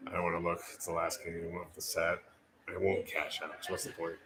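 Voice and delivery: low, quiet self-talk; nervous muttering. Real, not acted. Bettor arguing with himself over cashing out, nervous mutter. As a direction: mutter it low, under the breath.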